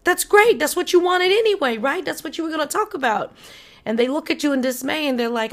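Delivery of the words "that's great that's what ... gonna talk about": much higher and louder, hammed-up fake cheer; mocking friend cheering the breakup; mock cheerful. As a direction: gush like a falsely upbeat friend. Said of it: "And you're like".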